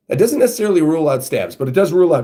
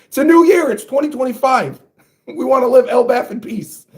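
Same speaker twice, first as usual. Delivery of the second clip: high-pitched, hammed-up children's pleas; pleading kids; 8 semitones higher than usual. Kids begging Luffy to stop, high mock pleading. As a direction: plead up high like scared schoolkids. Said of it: "the other kids are saying".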